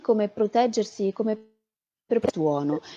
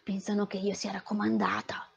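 softer whispered aside; 9 dB quieter than usual. Real, not acted. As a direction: drop to a whisper for the joke. Joking aside about favouritism. (sussurrato scherzoso)

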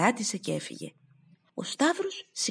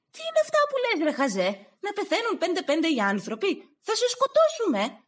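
outraged shout; 13 semitones higher than usual. Acted. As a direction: lash out at the fool. Συγγενείς εξαγριώνονται με τον Σταύρο. (εξαγριωμένοι συγγενείς φωνάζουν ψηλά και δυνατά)

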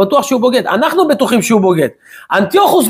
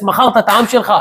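ordinary delivery

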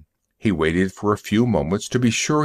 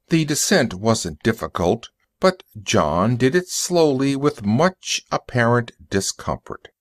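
ordinary delivery, nothing marked

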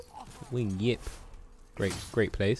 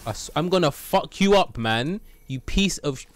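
angry; 8 semitones higher, 6 dB louder than usual. Real, not acted. Yelling at enemy in game.

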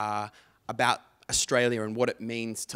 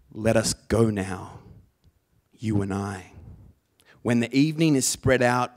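loud, measured slam delivery with big pauses; slammy beat poetry; 7 dB louder than usual. Shown as a demonstration. Poet performs T S Eliot for practice clicks, slam poetry style. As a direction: declaim it like a beat poet, phrase by phrase. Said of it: "in a really slammy kind of beat poetry style".

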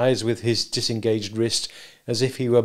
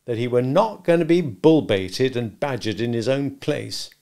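ordinary delivery

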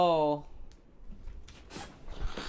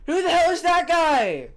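loud; 7 semitones higher, 5 dB louder than usual. Acted. Shocked loud question.

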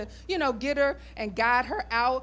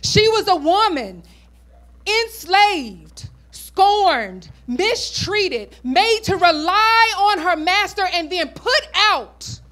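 louder, higher preacher's build; preaching at full force; 5 semitones higher, 6 dB louder than usual. Acted. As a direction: drive it home to the congregation.

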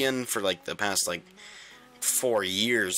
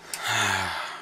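long, audible sigh. Real, not acted. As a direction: let out a long breath. Sighs while playing.

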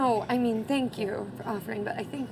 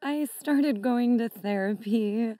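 normal talking voice

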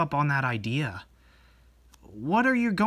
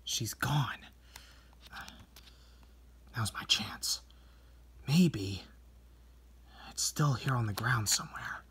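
hushed whisper, then quick; sneaky whisper; 5 semitones lower, 12 dB quieter than usual. Acted. Sneaking in while she's gone, whispered. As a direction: whisper so she won't hear.

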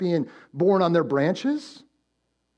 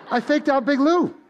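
laughing; 7 semitones higher than usual. Real, not acted. Laughing at fooling a friend.

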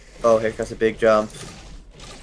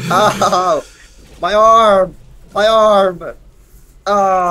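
hammed-up cries of pain, much higher and louder; mock pain cries; 8 semitones higher, 7 dB louder, much slower than usual. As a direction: yelp like your arm just broke, for laughs. Crashed skier in game, mock pain.

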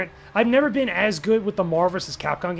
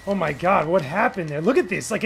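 ordinary talk

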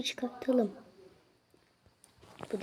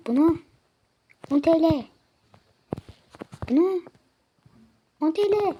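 higher, much louder price calls; loud haggling; 4 semitones higher, 7 dB louder than usual. Acted. Selling the bird, loud haggling. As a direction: shout the price like a market seller.

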